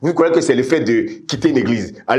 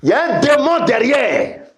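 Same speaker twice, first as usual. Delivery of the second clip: high, ringing warning cry; full-force preaching; 13 semitones higher than usual. Real, not acted. Preacher warning of a demon behind vaping, full-force preaching. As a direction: proclaim it high, leaning on DÉMON.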